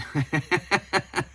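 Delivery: high, rapid chuckle; high-pitched run of giggles; amused laughter. Real, not acted. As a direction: burst out giggling at a naughty joke. Laughs at the risque comment.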